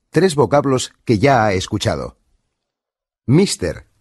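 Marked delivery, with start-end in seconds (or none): 3.2-3.9 MISTER with a tapped final r; Spanish accent. Shown as a demonstration. Instructor compares for learners, Spanish accent. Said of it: "entre la pronunciación española y".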